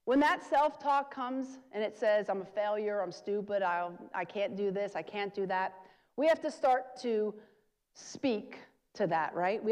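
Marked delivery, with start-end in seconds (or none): none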